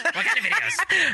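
high, eager mocking imitation; eager mimic. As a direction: squeal it like an excited perv. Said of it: "as soon as she said videos".

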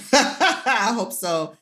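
laughing up high, then slow words; laugh, then say it slowly through the laugh. Real, not acted. The guest, moved by praise from the hosts, laughs then answers slowly.

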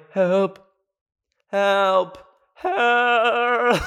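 mock cries for help. Stuck in bed, calling out for help, rising whimpers.

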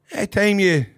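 loud, rough stab at Geordie; attempted Geordie accent. Guest imitates a Newcastle box owner, attempted Geordie accent. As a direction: imitate a Newcastle man, badly. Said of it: "was that your attempt at a Geordie accent?".

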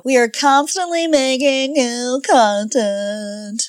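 slow, fake-weepy silly voice; host mock-crying a promo line; silly put-on voice. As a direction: whine the promo like a sobbing goof. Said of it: "you like that voice".

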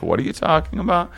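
quick, high-pitched alarmed question; retold freak-out. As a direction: re-enact your own rattled disbelief, fast. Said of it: "I started freaking the fuck out".

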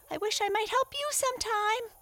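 very high small turtle voice; grateful tiny turtle; little turtle. A narrator's reading. Little turtle thanking frog.

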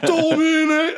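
very high, long-held chant-like cry; incantation cry. Acted. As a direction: wail the spell word high. Game master acts out spell caster's cry.

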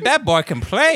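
high, loud mock admiration; mock impressed dad. Mimicking dad gushing over a drumline player. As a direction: gush over the drummer like dad did. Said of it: "He's like".

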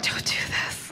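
soft whisper of dread; hushed nervous whisper. Retold or imitated. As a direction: whisper it under your breath, dreading it. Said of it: "I was like".

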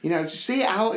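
high-pitched retold touchline call; mild shout from the sideline. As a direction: call it out like instructions to a player. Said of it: "I just shouted".

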